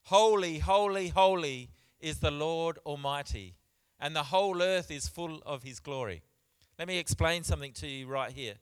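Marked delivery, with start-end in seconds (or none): 0.0-6.2 loud, ringing scripture proclamation; calling out. Acted. Seraphs calling to one another around the throne, proclaiming loudly. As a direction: call it out boldly, like angels to one another. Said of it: "they were calling out to one another".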